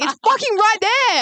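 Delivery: high, slow exasperated yell; mock scream. Host retelling yelling at flatmate about the lemon, mock scream. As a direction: yell it at her in mock fury. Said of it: "And I screamed at her".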